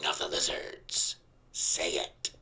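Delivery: unvoiced stage whisper. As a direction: whisper the prompt. Prompting in a whisper.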